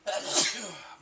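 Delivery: loud real sneeze. Real, not acted. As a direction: let a sharp sneeze out. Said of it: "I'm gonna sneeze again".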